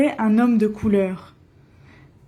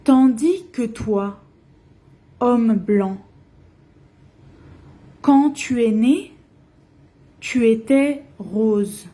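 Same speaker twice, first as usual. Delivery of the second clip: poem recitation. Acted. Teacher reading Senghor's poem aloud to learners, measured recitation. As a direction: recite each line and let it land.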